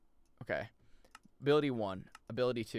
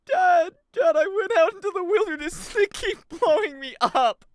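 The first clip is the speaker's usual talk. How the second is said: put-on high, loud whiny kid wailing to Dad; mock crying child; 13 dB louder than usual. Slime complaining to Dad that they keep blowing him up, mock childish wailing. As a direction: bawl like a kid tattling to his father. Whining to Dad about being blown up.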